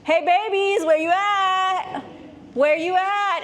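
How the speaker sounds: a mock parent hunting hidden kids; God looking for Adam and Eve, high sing-song call. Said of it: "God's like".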